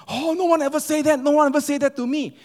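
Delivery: high, loud, affronted wail; flustered lady. Retold or imitated. Hostess told her soup is awful, acting flustered. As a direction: protest as a mortified hostess. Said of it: "the lady was so flustered".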